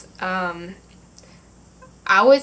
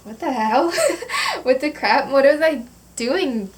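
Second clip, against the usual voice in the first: higher, laughing through the retold confusion; laughing disbelief; 5 semitones higher than usual. Recalling finding her blanket next morning, laughing in disbelief. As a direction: giggle out the bewildered questions.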